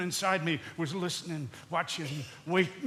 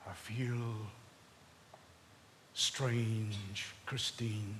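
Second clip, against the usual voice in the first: faint unease; 8 semitones lower, 13 dB quieter, much slower than usual. Acted. Husband feels unwell, speaks faintly.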